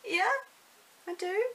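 high, giggly eager answer; eager reply. Retold or imitated. Vlogger replaying her chuffed answer to a school mum. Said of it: "I was, I was like".

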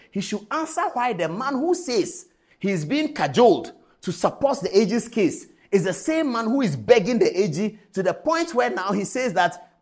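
usual speaking voice

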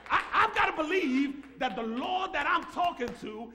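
loud, slow, ringing preacher's shout; full-force preaching. Acted. Pastor shouting his faith to the congregation, full-force preaching. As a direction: preach it to the rafters.

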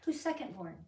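normal talking voice

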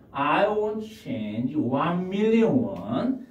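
very slow, clear; slow model request. Shown as a demonstration. Teacher modelling the request slowly. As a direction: say it word by word. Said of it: "teacher models request".

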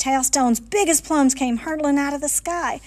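ordinary delivery

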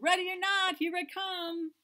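high, loud game call. Acted. Seeker calling to hidden mouse, high and loud. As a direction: sing it out like the seeker.

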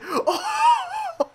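very high laughing whoop; gleeful shriek. Real, not acted. Player overwhelmed by a brutal hit, gleeful shriek. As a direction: let out a high, delighted scream. Screams with glee.

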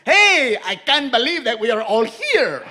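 put-on grudging read in a Mexican accent; Mexican gardener accent. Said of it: "they want me to play this like a gardener".

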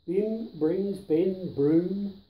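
plain reading voice

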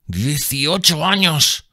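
sailor's slow reply; puzzled sailor. A narrator's reading. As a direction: answer slowly, unsure why. Sailor answers slowly.

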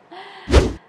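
short bright laugh; burst of laughter. Real, not acted. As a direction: laugh out loud. Laugh after the reveal.

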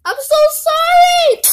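high, loud wail of grief; anguished scream. Real, not acted. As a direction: scream the apology as he dies. Anguished cry as friend dies.

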